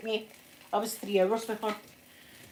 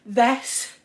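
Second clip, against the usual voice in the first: slow, drawn-out, louder words round the mouthpiece; through gas and air; 4 semitones higher, 6 dB louder, much slower than usual. Retold or imitated. She shows how she spoke on gas and air in labour, slow and loud. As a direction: drag each word as if high on gas. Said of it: "I was doing that".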